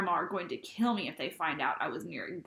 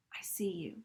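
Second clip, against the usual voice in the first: whisper; 5 dB quieter than usual. Acted. Ghost girl sees narrator.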